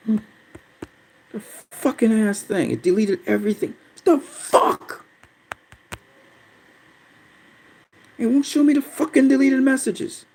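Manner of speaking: high, dragged-out frustrated groan; venting at the broken chat tool; real frustration. Real, not acted. Streamer fuming to viewers about deleted messages, high strained frustration. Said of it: "I'm so pissed right now".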